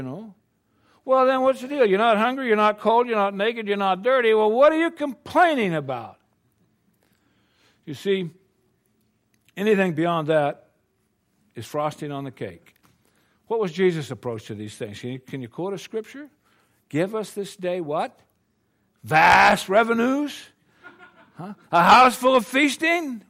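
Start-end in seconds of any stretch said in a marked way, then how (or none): 1.0-6.2 higher and louder, scolding himself in mock exasperation; mock exasperated outburst. Preacher mock-scolds himself for complaining, raised and exasperated. 19.0-23.2 higher, louder, slow mock disbelief; mocking incredulity. Preacher scoffs at greedy prayers, high and incredulous.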